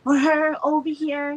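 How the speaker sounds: high, loud indignant protest; hammed-up heartbroken complaint to the divine; outraged self quote. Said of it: "And I'm like".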